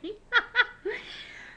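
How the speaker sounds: high, bright burst of real laughter; a high giggle. Real, not acted. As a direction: let a big laugh out at yourself. Laughing at her own soccer plans.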